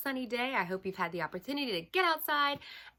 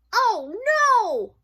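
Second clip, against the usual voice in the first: high, loud storybook wail; dismayed wail; 14 dB louder than usual. Acted. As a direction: wail in dramatic despair as a little mouse. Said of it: "wailed Mouse".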